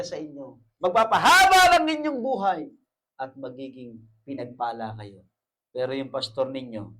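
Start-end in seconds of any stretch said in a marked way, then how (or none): 0.8-2.7 loud, high, slowed sermon cry; full-force preaching. Acted. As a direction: preach it out to the congregation.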